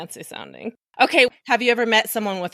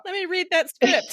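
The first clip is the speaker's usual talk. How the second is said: high, breezy Jerry voice; imagined Jerry voice; 9 semitones higher than usual. Pretend. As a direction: play Jerry asking casually. Continues imagined Jerry call.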